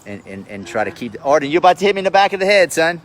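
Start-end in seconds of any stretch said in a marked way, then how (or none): 1.2-3.0 sharp scolding. Real, not acted. Father warning son Arden not to hit him, loud and fast. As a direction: snap at him to stop it now.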